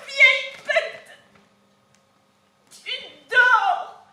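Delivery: Vieille bête crié beaucoup plus haut et plus fort, traîné; cri de reproche. Acted. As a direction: hurler le reproche imaginé de la mère. Nourrice imite la mère morte. (la nourrice imite Jocaste qui lui crie dessus)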